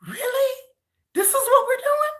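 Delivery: high, disbelieving "really?"; incredulous. Retold or imitated. Retelling her reaction to the fans, incredulous.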